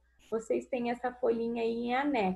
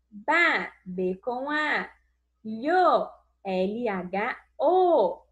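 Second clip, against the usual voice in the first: L H O spelt out slow, letter by letter; slow spelling; slower than usual. Shown as a demonstration. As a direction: spell L H O slowly for children.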